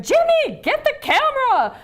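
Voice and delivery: very high, loud yell across the room; excited yell. Retold or imitated. Mother-in-law yelling to husband, high excited shout. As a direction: shout for him to grab the camera. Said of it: "She's like".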